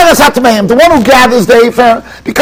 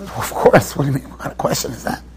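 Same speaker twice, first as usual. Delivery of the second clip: hushed reply; 10 semitones lower, 13 dB quieter than usual. Retold or imitated. Disciple's startled retold reply.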